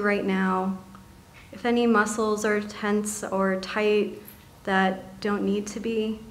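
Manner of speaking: soft, calm meditation voice with long pauses; guided meditation. Acted. Meditation leader settling seated listeners into body and breath, softly guiding.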